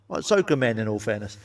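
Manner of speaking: usual speaking voice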